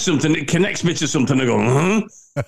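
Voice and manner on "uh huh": [name]. Acted. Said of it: "[name] is leaving the building".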